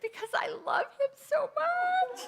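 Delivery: high teary plea, half laughing; be the lovesick teen, crying and laughing. Retold or imitated. Teen self crying to dad.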